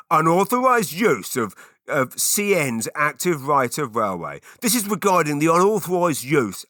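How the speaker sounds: put-on high, loud officious British accent; put-on British official voice. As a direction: read it as a stern British letter-writer. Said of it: "British accent for this".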